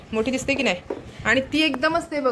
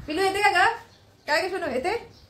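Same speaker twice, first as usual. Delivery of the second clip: coaxing pet talk; 7 semitones higher than usual. Real, not acted. Calling the cat sweetly.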